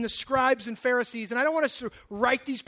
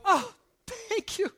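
high-pitched, put-on delighted thanks; mock gratitude; 9 semitones higher than usual. Someone just called a sinner, mock-grateful. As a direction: gush as if thrilled by the insult.

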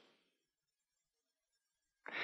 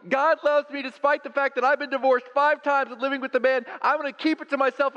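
very high, louder mock woman's voice; woman at the well; 6 dB louder than usual. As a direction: ham up the excited woman, high and loud. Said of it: "she was like".

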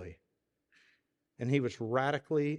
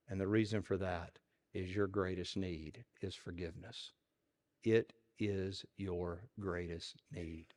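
a low, soft, earnest sermon close; preaching; 4 semitones lower, 6 dB quieter than usual. Acted. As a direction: draw them in gently. Sermon lands its point.

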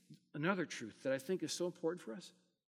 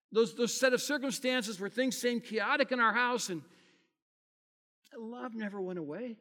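ordinary talk, nothing marked

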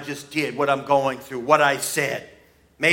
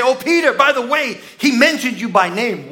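excited news; 7 semitones higher, 5 dB louder than usual. Acted. The lady tells Peter.

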